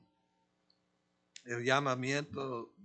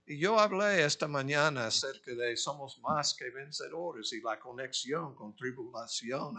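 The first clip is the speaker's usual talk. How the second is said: ordinary delivery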